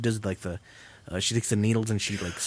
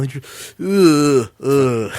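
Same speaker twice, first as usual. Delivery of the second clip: loud mock wince groan; mock pained ugh; 3 semitones higher, 9 dB louder, much slower than usual. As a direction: groan as if the needle hits. Imitating needle through cheek.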